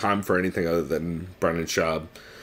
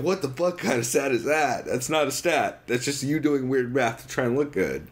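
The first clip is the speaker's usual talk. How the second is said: higher-pitched mock exasperation; exasperated disbelief; 5 semitones higher than usual. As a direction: object as if fed up with nonsense stats. Acted exasperated reply to stat quote.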